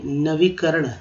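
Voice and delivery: normal talking voice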